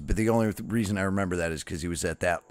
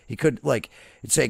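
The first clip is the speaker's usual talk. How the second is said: ordinary talk, nothing marked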